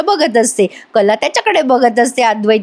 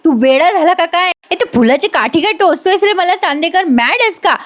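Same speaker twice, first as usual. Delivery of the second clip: higher, a touch of retold anger; annoyed scolding; 6 semitones higher than usual. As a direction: snap at him, mildly irritated. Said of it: "कला चिडते आणि म्हणते". (हलक्या रागाने दटावणे)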